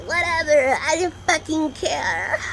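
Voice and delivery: slow, drawn-out put-on whine; mock whiny dismissal. Vlogger brushes off why she got the tattoo, mock whiny dismissal. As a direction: drag it out, rising into mock exasperation.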